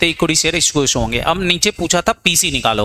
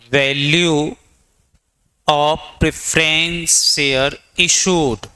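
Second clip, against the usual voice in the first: very slow dictation; much slower than usual. Shown as a demonstration. Teacher repeats the dictation slower.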